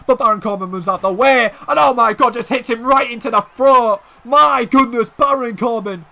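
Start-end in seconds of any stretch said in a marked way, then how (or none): 1.7-6.0 raised, louder commentator's shock; shocked exclamation. Real, not acted. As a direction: cry out at the brutal hit. Shocked at a brutal hit.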